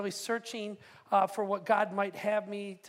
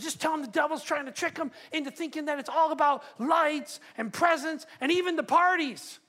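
put-on higher and louder, a boy's eager plea; fired-up kid; 6 semitones higher, 6 dB louder than usual. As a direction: push it like an excited ten-year-old. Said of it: "he's all passionate and fired up".

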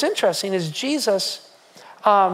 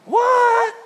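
high, loud disbelieving "What? What?"; incredulous crowd; 13 semitones higher, 3 dB louder than usual. Retold or imitated. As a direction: exclaim in shocked disbelief, way up high. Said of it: "They were like".

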